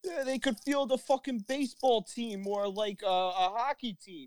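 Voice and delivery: high joking Jersey voice; high hammed-up Jersey accent; mock Jersey accent. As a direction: play the excited local guy.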